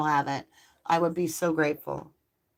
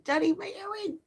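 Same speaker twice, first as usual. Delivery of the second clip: much higher playful no; squeaky scolding; 13 semitones higher than usual. Real, not acted. Puppy chewing her earring.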